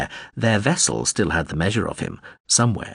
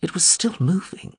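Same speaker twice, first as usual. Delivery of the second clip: glum; 10 semitones higher than usual. A narrator's reading. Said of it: "she added glumly".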